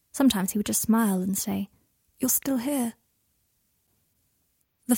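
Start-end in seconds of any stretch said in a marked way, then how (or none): 2.2-2.9 soft sleepy whisper; breathed, tender and drowsy. Acted. Draco smiling, half asleep.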